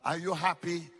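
usual speaking voice

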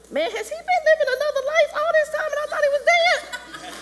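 very high, loud, racing squeal; excited. Retold or imitated. Viewer learns Mufasa lives, wildly excited and shrieking. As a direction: burst out in hammed-up, squealing disbelief. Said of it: "I'm excited".

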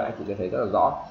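usual speaking voice

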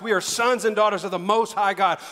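ordinary talk, nothing marked

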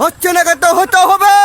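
loud, high, demanding rant. Real, not acted. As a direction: shout the demand, worked up. Stoned man ranting at interviewer.

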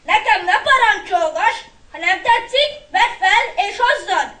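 loud, high, indignant; boy in a heated quarrel; angry retort. A narrator's reading. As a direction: snap back at your brother. Acted quarrel between brothers.

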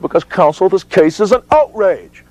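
high, ringing indignation; outraged. Retold or imitated. Expert witness answering opposing counsel, outraged.

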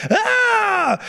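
high-pitched imitated lady's scream; shrieky mock outcry. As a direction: scream it high without much volume. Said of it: "a lady screams in the back".

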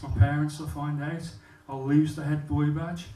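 ordinary delivery

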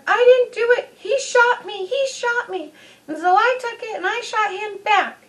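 put-on high, whiny crying kid voice; whiny brat child. Young girl whining at the angry dad, high and teary. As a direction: whine it out like a tearful little brat. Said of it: "whiny little brat child".

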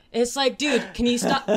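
fed up and pleading; exasperated plea. Real, not acted.

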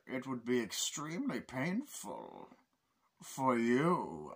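slow, stretched villain impression; Bane. Villain answering his captor, slow chilling impression. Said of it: "every line he gives is so fucking chilling".

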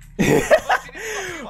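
loud high cackle; burst of laughter. Real, not acted. Gamer reacting to a teammate's complaint, loud laugh. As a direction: crack up at a friend's outburst.

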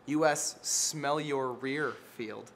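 "U S Smell Your Rear Field" drawn out slowly, word by word; deadpan unveiling of "Smell Your Rear"; slow punchline. Shown as a demonstration. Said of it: "Because dogs".

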